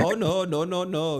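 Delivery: put-on playful Jamaican mom accent. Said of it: "with a, a little Jamaican accent".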